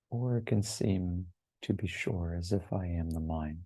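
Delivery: unhurried contemplative guidance, letting each phrase settle; guided meditation. Real, not acted. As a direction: lead listeners gently inward, phrase by phrase.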